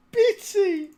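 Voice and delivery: high loud squeal of the name; laughing squeal. Real, not acted. Repeating a funny name to himself, squealing with laughter.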